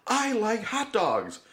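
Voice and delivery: high, slow, wonder-struck imitation; awestruck ex-prisoner. Co-host imitates James Earl Jones's character at a hot dog stand, slow and awed. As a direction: savor each word like a freed man seeing food. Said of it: "he has this moment where he's like".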